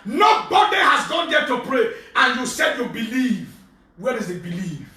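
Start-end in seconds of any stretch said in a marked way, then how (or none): 0.0-1.9 slow, loud preacher's shout; preaching at full force. Acted. Pastor rebuking the congregation, loud slow preaching. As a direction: thunder it at the flock.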